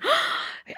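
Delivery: sharp imitated gasp of horror; mock horrified intake of breath; shocked gasp. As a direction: gasp like a scandalised friend. Imitates shocked callers' reaction.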